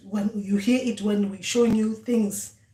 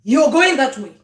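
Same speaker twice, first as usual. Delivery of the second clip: louder, higher blunt order; direct command; 4 semitones higher, 10 dB louder than usual. Retold or imitated. Ancestors bluntly ordering the dreamer, loud command. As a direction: tell them flatly where they're going.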